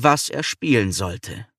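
plain reading voice

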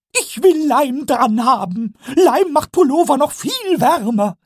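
very high, slow goblin voice, cheeky; Pumuckl correcting. Acted. Pumuckl corrects Eder, very high and pleased. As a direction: correct him like a smug little kobold.

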